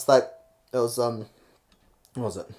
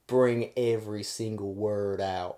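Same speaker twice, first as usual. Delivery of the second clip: slow, stretched-out Southern drawl; 3 semitones lower, 6 dB quieter, slower than usual. Shown as a demonstration. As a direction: drag each word out like a Southerner. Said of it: "the southern drawl".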